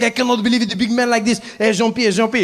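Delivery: put-on high, incredulous French-accented ranting. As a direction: marvel as a scandalised Frenchman. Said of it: "the French guy".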